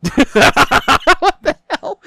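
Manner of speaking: loud, high burst of laughter; helpless laughing, words squeezed out; laughing disbelief. Real, not acted. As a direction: crack up in baffled amusement. Laughing at co-host's odd remark.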